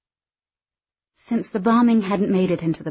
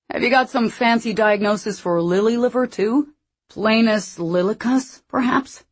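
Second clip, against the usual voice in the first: higher-pitched character voice, mocking; taunting lumberjack; 4 semitones higher, 4 dB louder than usual. A narrator's reading. Rory goading Ellis about being a coward, taunting. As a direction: needle him with mock-polite questions.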